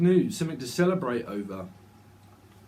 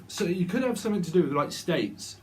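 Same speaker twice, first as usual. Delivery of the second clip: ordinary delivery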